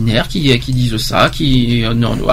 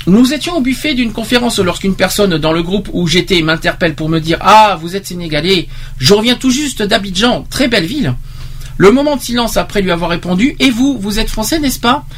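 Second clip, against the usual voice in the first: raised, animated reading voice; testimony read aloud; 5 semitones higher than usual. Acted. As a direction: read the anecdote out, lifting the pitch on the quotes. (lecture animée d'un témoignage)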